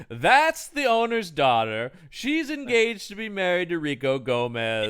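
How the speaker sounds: slow, hammed-up explaining voice; mock exposition. Podcaster imitating the friend's instant exposition, slow mock explaining. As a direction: spell out the backstory slowly, as a send-up. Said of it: "I love his instant exposition here".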